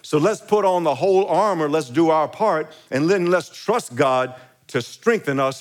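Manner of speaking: rally the church; stirring preacher's call; rallying exhortation. Acted. Exhorting the congregation.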